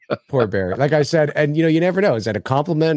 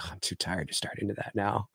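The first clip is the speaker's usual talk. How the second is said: much softer, weary retold aside; tired mumble; 3 semitones lower, 14 dB quieter than usual. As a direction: play too worn out for a late movie. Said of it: "it's always, like, eleven thirty at night".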